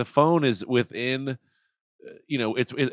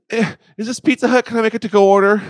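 much higher, louder cheery order; chirpy order; 11 semitones higher, 11 dB louder than usual. Acted. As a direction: switch instantly to bright service voice. Abrupt switch to ordering pizza.

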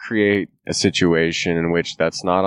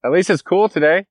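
loud, high call across the street; hollering; 12 semitones higher, 4 dB louder than usual. Real, not acted. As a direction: call out to passing people. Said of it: "I love hollering at people".

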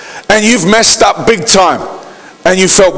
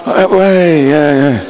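half-hearted cheer sinking lower; feigned delight; 3 semitones lower, much slower than usual. Retold or imitated. Gift receiver hiding disappointment at Christmas, feigned delight. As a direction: fake a smile through disappointment. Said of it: "they've put on the fake smile".

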